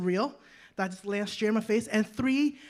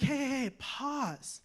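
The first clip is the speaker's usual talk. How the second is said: slow calming pause; 2 semitones higher, 4 dB quieter, slower than usual. Retold or imitated. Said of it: "Paul's like".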